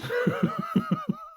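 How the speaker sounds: high-pitched burst of laughter; laughing. Real, not acted. Reader loses composure mid-story, high helpless laughter. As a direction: break up into high, squealing giggles.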